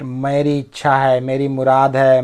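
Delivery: normal talking voice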